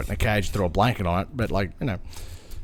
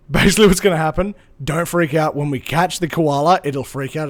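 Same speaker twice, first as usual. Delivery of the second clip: fast, louder no-nonsense briefing; quick briefing; 2 semitones higher, 6 dB louder than usual. Retold or imitated. Rescuer warning the boys before the catch, fast and louder. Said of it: "And she goes".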